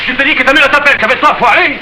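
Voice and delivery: high, insistent call; impatient repeat. Acted. Marius repeats her mother's call to Fanny, impatient.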